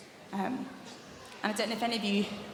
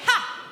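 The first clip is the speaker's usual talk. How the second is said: a sharp, much higher, louder "Ha!"; loud diaphragm "Ha"; 13 semitones higher, 9 dB louder than usual. Shown as a demonstration. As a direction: punch it out from the belly. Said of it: "Try again".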